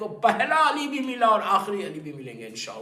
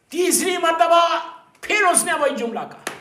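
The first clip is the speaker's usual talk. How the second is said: dramatic exclaim; 9 semitones higher, 9 dB louder than usual. A narrator's reading. Dramatic storytelling climax.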